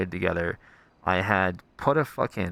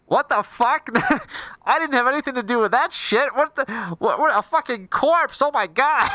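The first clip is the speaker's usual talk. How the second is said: high, loud, laughing freak-out; mock panic; 13 semitones higher, 4 dB louder than usual. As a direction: squeal in fake panic through laughter. Acted panic over the corpse.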